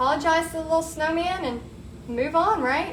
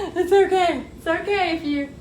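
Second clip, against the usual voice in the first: laughing reassurance; 5 semitones higher, 3 dB louder than usual. Acted. Reassuring after whispered take.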